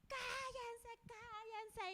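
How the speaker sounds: high drawn-out "shut up" cry; mock crazed yelling. Neighbour yelling at the worshippers, mock crazed yelling. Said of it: "empezaba como loca a gritar".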